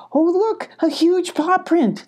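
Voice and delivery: high, drawn-out exclamation of discovery; thrilled high story-voice; excited discovery. Acted. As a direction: exclaim at the find, pitch way up. Molly excitedly spots a clue.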